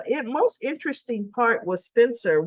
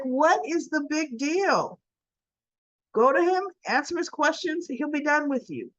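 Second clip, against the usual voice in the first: higher, impatient mother-figure lecture; exasperated scolding; 8 semitones higher than usual. Retold or imitated. Maxie scolding Cody about the questions, exasperated. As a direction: brush off his worries, fed up.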